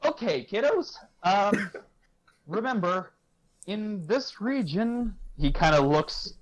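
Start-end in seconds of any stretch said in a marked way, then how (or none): 0.0-5.2 higher-pitched, measured professor; professor addressing trainers. Acted. Professor opening the league match for young trainers, lightly voiced. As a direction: address the kiddos in a raised, lilting voice. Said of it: "he walks out and goes".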